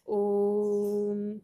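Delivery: ओम को बहुत लंबा खींचकर, ओ पर देर तक रुकें; प्लुत ओ: ओम का ओ दो मात्राओं से भी लंबा; खिंचा हुआ ओम. Shown as a demonstration. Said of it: "जैसे ओम … दो से अधिक मात्राओं का समय". (ओम बहुत धीमे और खींचकर)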